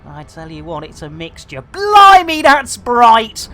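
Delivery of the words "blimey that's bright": high, loud blimey; jolted by the glare; surprised exclamation. Real, not acted. Streamer jolted by a bright star, surprised.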